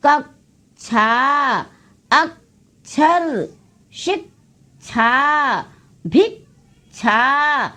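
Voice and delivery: कक्षा, अक्षर, शिक्षा, भिक्षा stretched out so the half क is heard before श; drawn-out teacher pronunciation, letting the क्ष cluster ring; slow demonstration. Shown as a demonstration. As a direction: stretch each word slowly to show the half sound. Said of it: "देखिए, आप हम बोल रहे हैं तो उसमें आधा".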